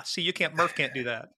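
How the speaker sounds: ordinary delivery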